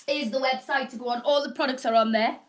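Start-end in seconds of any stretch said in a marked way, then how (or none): none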